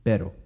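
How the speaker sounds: pero with a quick single tap. Shown as a demonstration. Teacher modelling the tapped r. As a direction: flick the r once in pero. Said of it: "the tap or the flap".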